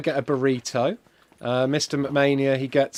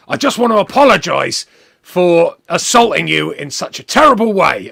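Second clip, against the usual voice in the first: cockney; 3 semitones higher, 8 dB louder than usual. Retold or imitated. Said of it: "He's a cockney".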